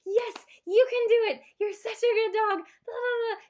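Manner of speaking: high, loud, gushing praise; cheerleader voice. Shown as a demonstration. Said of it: "You're their cheerleader".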